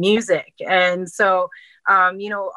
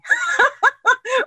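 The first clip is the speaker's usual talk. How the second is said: high laugh. Real, not acted. Laughs at own drowned-rat image.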